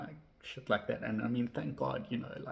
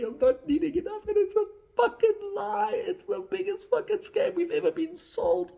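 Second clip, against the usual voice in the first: much higher, much louder outraged yelling; furious shout; 8 dB louder than usual. Real, not acted. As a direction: shout the scam out in real fury. Furious rant about money scam.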